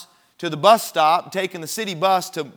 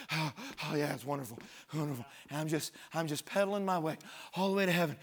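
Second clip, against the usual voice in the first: much softer, exhausted half-hearted mock voice; 4 semitones lower, 13 dB quieter, faster than usual. Preacher mocking tired Christians, exhausted soft mumble. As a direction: mumble like a worn-out believer going through the motions. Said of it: "I see a lot of Christians going".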